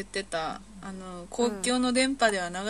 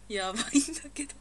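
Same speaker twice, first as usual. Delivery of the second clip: breathless from laughing; coughing through laughter; laughing fit, choking; 4 semitones higher than usual. Real, not acted. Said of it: "喉が".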